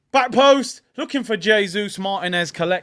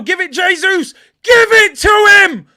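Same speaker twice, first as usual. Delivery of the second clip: frantic shout; 10 semitones higher, 15 dB louder than usual. Real, not acted. Urging the pass to Jesus.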